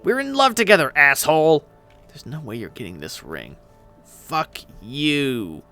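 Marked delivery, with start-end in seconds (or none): 0.0-1.6 loud, high-pitched retort; indignant outburst. Acted. Acted squabble over a ring. 2.1-3.6 very low, hushed refusal; stage whisper. Acted. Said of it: "his loud, shitty whispering".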